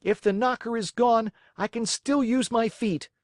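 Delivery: stubborn anger at the door; angry. Acted. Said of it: "blind with rage".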